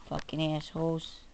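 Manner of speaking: ordinary talk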